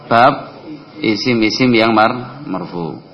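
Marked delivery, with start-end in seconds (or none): none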